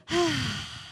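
short falling "ahh" sigh; sigh of release. Shown as a demonstration. Preacher demonstrating release to congregation, relieved sigh. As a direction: breathe out an audible relieved ahh. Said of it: "We need to release".

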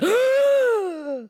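short loud gasp for air. Acted. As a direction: gasp like a stranded fish. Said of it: "I've been going".

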